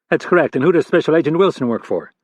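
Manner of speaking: a senior director grilling a junior agent; stern FBI boss. Acted.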